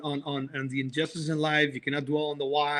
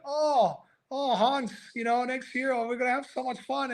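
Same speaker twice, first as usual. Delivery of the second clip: carefree German; 6 semitones higher than usual. Retold or imitated. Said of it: "What were two Germans".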